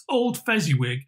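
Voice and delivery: ordinary delivery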